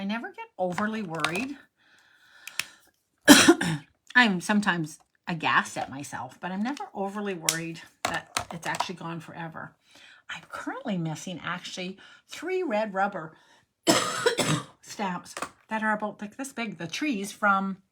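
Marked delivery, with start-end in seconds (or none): 3.3-3.8 coughing fit. Real, not acted. Coughing repeatedly during stream. 13.9-14.6 breathy cough mid-sentence; coughing fit. Real, not acted. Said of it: "I'm really sorry I'm coughing so much".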